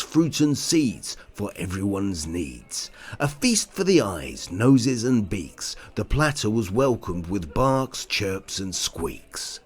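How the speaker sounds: ordinary delivery, nothing marked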